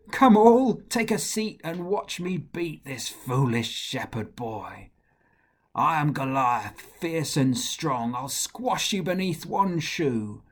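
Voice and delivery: loud, scornful giant voice; Goliath mocking David. Goliath mocks the shepherd boy, loud and scornful. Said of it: "and he laughed until he shook".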